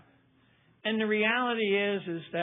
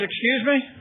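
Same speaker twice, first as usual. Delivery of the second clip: higher, louder incredulous question; taken aback, pitched up and loud; startled disbelief; 7 semitones higher, 6 dB louder than usual. Retold or imitated. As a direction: do a startled double take. Startled by the priest's famous name.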